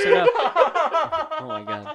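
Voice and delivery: high, helpless laughing voice; laughing too hard to talk; talking through laughter. Real, not acted. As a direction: crack up while trying to make your point. Cracking up at dog joke.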